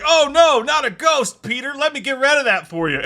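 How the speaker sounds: high, loud mock alarm; Santa Claus. Reviewer mimicking Santa banishing a ghost, mock alarm. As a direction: ham up a helpful movie character, pitched high and loud.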